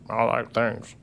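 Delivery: slow-witted politician impression, lightly voiced; a short, understated impression of a senator; McConnell. Co-host mocking a senator who cares about little, light impression. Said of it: "That's a great McConnell impression".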